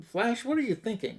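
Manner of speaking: mock disbelief. Green Lantern questions Flash's offer.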